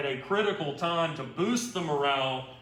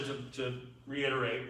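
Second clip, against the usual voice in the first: ordinary talk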